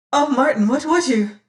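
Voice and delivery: high, loud frightened plea; alarmed cry. Acted. Damaris pleads with Martin, high and alarmed. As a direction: call his name in sudden fear.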